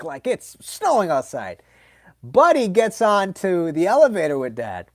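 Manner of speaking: usual speaking voice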